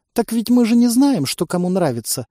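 высоко и громко удивлённо; удивись наивно, как существо. Acted. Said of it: "удивилась Дологнистка". (Дологнистка удивлённо объясняет людям, высоко и громко)

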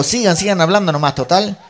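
high, loud mock sarcasm; sarcastic exasperation. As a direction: goad the talkers as a fed-up moviegoer. Espectador sarcástico en la riña. (sarcasmo exasperado en voz aguda)

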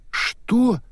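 high startled yelp; shocked. Acted. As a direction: gasp it out in disbelief. Shocked outburst at arrest. (потрясённый возглас)

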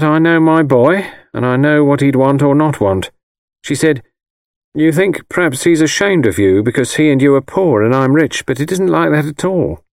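plain-spoken mother, pitched above narration; mother recounting a visit. A narrator's reading. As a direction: retell the exchange as the proud mother.

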